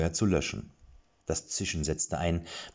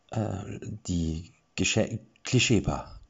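shy answer; 3 semitones higher, much slower than usual. A narrator's reading. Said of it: "antwortete Erik leise".

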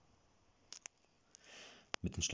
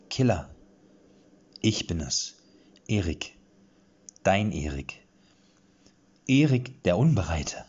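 fluttering voice; 6 dB louder than usual. A narrator's reading. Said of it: "Ein leichtes Flattern ermächtete sich seiner Stimme".

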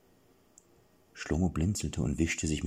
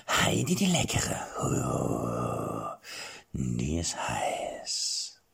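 put-on lecherous old king; 5 semitones lower, much slower than usual. King dreams aloud about the princess, whispering. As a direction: savor it like a dirty old man.

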